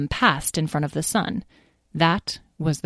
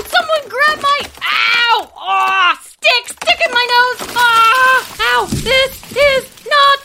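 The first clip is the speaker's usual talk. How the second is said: high shrieking tumbling-down-cliff yells; panicked yelling; 7 dB louder, slower than usual. A narrator's reading. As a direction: scream in panic while falling. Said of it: "Lyle didn't stop yelling the whole time".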